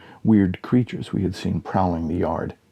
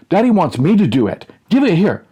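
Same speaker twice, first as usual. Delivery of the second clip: put-on bickering kid; 9 semitones higher, 6 dB louder than usual. Kids arguing over pole.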